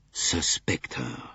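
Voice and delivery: drawn out slow and thoughtful; slowly. A narrator's reading. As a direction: let the words come slowly, pondering. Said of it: "said Monsieur Bouc slowly".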